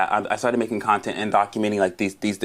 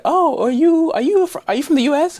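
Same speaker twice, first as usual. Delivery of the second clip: much higher, louder, surprised French local voice; mock French local; 5 dB louder than usual. French stranger hearing an American accent, high and thrilled. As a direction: squeal it like a delighted stranger. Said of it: "It's like".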